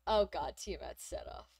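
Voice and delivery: ordinary talk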